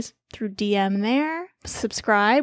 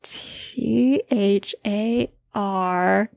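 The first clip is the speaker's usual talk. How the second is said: slow spelling out; much slower than usual. Shown as a demonstration. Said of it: "Spell it".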